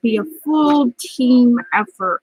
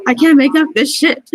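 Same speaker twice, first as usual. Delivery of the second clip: tearful outburst; 4 semitones higher, 4 dB louder than usual. Real, not acted. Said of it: "I can't believe I'm gonna start crying".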